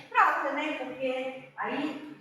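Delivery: ordinary talk, nothing marked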